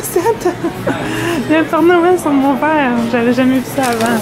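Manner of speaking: high, delighted exclamations; excited. Real, not acted. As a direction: gush over the cup. Excited about Santa cup. (émerveillée, voix aiguë)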